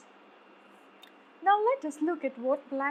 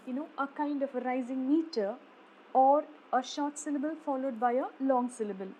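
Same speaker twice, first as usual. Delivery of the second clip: ordinary talk, nothing marked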